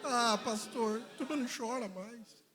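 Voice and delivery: soft, high imitated complaint; complaining churchgoer. Churchgoer complaining to the pastor that nobody cries, softly imitated. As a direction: voice a churchgoer's small, soft protest.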